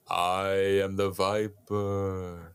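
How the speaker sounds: slow, drawn-out eerie voice; creepy voice. Retold or imitated. Creepy stranger outside the kid's window, slow eerie voice. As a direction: stretch each word out like a lurking stranger. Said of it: "he hears this voice going".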